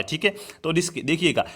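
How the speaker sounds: ordinary talk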